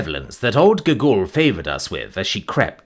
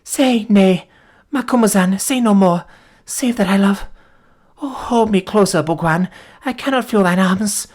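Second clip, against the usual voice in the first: put-on wounded girl telling what happened; dying young woman; 7 semitones higher than usual. A narrator's reading. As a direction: tell it as your strength ebbs. Voices the dying Folata.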